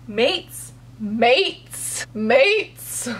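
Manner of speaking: mock disbelief, each mates rising; baffled repeat. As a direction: echo the word, stunned. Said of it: "And she was like".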